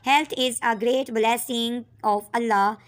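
very slow, clear dictation; dictation pace. Shown as a demonstration. Teacher repeating the sentence, very slow. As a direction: read it out word by word for writing. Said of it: "इसको हमने use करके एक sentence में किया".

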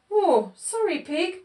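high, mildly guilty apology. A narrator's reading. Goat says sorry to Pig after licking the spot, mildly guilty. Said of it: "said Goat guiltily".

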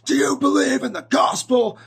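loud, high mock-zealous holler. Zealot confronting strangers in the street about the gospel, shouting. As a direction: holler it like a fired-up street evangelist. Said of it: "he raised his voice".

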